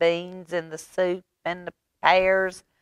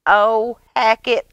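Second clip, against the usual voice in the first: exasperated sigh; 6 semitones higher, 7 dB louder than usual. Acted. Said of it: "Ivy sighed".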